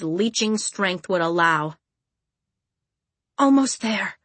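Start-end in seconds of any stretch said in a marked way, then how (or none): none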